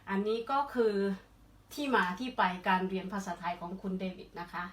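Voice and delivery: usual speaking voice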